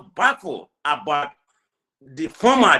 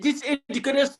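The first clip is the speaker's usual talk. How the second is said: retold outburst at diaspora Nigerians; 6 semitones higher than usual. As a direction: scold them, pitch up and push. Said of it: "I said".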